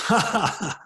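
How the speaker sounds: high, loud mock laugh from the heavens; God's amused ha ha ha; booming laugh. God laughs at the professor.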